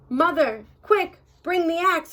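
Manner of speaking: high, loud urgent shout; boy shouting to mother for the axe. Acted. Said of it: "he shouted".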